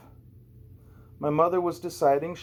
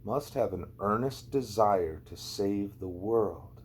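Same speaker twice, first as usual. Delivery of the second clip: slower, softer, dwelling on each word; slow thoughtful reread; 4 semitones lower, 4 dB quieter, slower than usual. Shown as a demonstration. Girl rereads the want ad slowly and softly to herself. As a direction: reread the ad slowly, letting it sink in. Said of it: "took another look at the second sentence".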